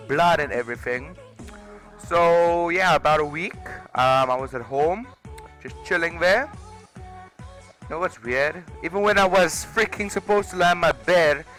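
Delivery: usual speaking voice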